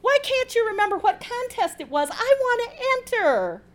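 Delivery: very high-pitched whine of envy; envious complaint. A narrator's reading. Paula wishing she could enter the contest too, envious. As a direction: whine it high, all the way through. Friend envies the contest win.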